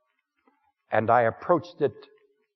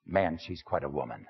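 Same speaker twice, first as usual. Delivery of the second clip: softer, knowing and appreciative; confiding; 7 semitones lower, 8 dB quieter than usual. Acted. Man confiding again to the congregation about his woman, softly. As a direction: drop the voice and savour it.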